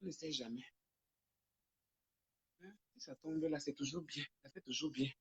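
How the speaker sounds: very soft, away from the audience; hushed phone conversation; private phone aside. Real, not acted. Takes a private phone call mid-livestream.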